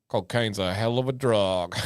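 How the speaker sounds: low, soft deadpan quote voice; a lightly voiced deadpan comic line; mock quote. Quoting a comedy bit to chat, low deadpan.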